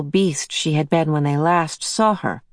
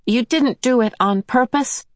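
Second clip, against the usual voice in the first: demanding; 7 semitones higher than usual. A narrator's reading. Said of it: "Charles demanded".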